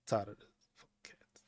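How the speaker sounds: a quiet grumbling whisper; unvoiced muttered complaint; whispered aside. Real, not acted. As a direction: whisper it under your breath. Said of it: "muttered aside".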